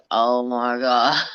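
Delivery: low, slow mock groan; weary, drawn-out retold complaint; retold exasperation. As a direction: drag it out low, imitating a fed-up wait. Bored child waiting in grandpa's truck.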